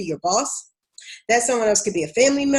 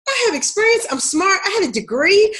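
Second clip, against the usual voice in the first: high, louder, frustrated mock protest; exasperated self-quote; 10 semitones higher, 4 dB louder than usual. Retelling her job-search frustration, high exasperated whine. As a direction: pitch it way up like you can't believe it. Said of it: "And I'd be like".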